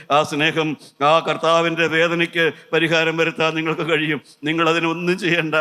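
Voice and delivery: normal talking voice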